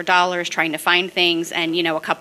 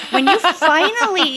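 high, louder words breaking into laughter; laughing; 11 semitones higher, 6 dB louder than usual. Real, not acted. Guest telling her pogo ball image, laughing. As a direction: laugh through the punchline.

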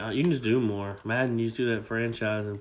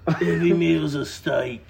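slow, loud, higher mock Australian accent; 6 semitones higher, 8 dB louder, slower than usual. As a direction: ham up a bad Australian accent. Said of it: "The accent I'm doing".

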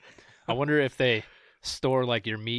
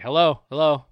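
calling hello; 3 semitones higher, 9 dB louder than usual. Retold or imitated. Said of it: "And I was like".

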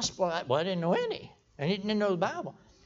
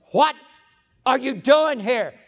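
loud, slow, raised demand; insistent repeat; 4 semitones higher, 9 dB louder, slower than usual. Retold or imitated. Holy Spirit asking the dancer once more, loud and insistent. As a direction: hammer out each word slowly and loudly.

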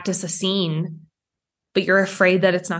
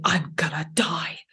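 breathy whisper, almost nothing; hushed mock dread; 4 semitones lower, 6 dB quieter than usual. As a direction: whisper it like a secret terror. Voicing primal fear.

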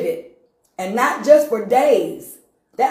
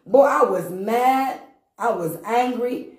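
slow emphasis; 3 semitones higher, slower than usual. Real, not acted. Recalls anger, slow.

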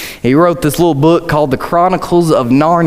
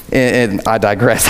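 ordinary talk

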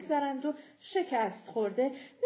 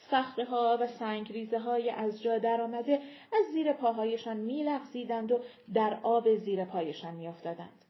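ordinary delivery, nothing marked

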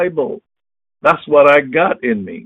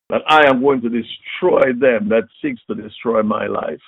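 normal talking voice

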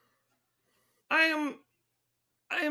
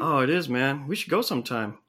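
much lower dude voice; alien-changed guy; 11 semitones lower than usual. Acted. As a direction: drop way down into a laid-back bro. Same low character.

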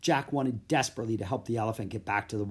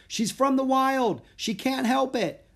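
high-pitched, raised-voice defence; crying out; 13 semitones higher, 5 dB louder than usual. A narrator's reading. Girl defends elephant to angry merchants, crying out. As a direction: call out an excuse over angry people. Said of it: "Annie cried".

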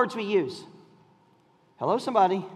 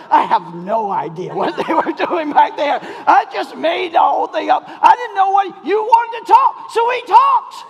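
loud, high, laughing admission; husband admitting he made it all up, laughing loudly; laughing confession; 4 semitones higher, 4 dB louder than usual. Retold or imitated. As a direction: confess through laughter, rising. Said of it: "I was like".

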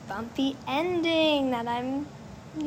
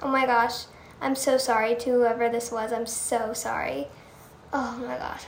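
ordinary talk, nothing marked

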